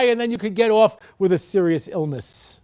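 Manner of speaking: usual speaking voice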